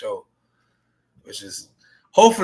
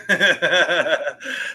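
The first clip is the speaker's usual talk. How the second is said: laughter; 6 semitones higher, 4 dB louder than usual. Real, not acted. Laughing at his own story.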